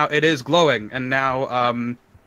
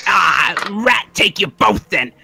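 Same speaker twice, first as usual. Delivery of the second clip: snarling rat; 4 semitones higher, 5 dB louder, slower than usual. Acted. GM voices rat starting fight.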